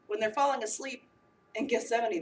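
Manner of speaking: normal talking voice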